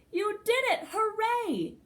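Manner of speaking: high-pitched, delighted congratulation; jubilant cheer. A narrator's reading. Great-great-aunt celebrating Rosie's first try, jubilant cheer. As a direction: cheer on a child's first attempt.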